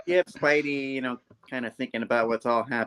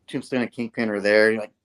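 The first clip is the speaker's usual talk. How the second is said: usual speaking voice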